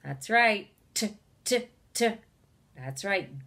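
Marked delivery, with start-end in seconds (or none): 0.9-2.1 "T" as three short separate tuhs; isolated T sound. Shown as a demonstration. Teacher gives goat's last sound, "t" as separate tuhs. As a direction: tap out each "t" as a crisp tuh. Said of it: "at the end of goat".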